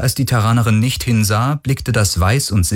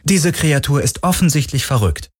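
higher-pitched, dismissive boredom; affected boredom; pointedly bored; 7 semitones higher than usual. A narrator's reading. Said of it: "betont gelangweilt".

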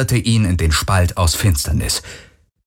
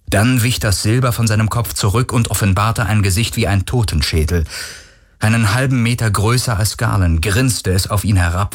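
usual speaking voice

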